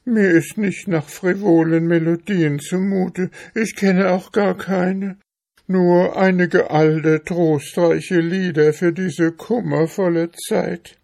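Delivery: high-pitched, pleading little mouse; poor hungry mouse, pitched high; plaintive mouse. Acted. Voices the mouse in the story.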